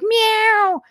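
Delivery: high mocking meow; a high catty little meow. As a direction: mimic politicians as bickering cats. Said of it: "and they're like".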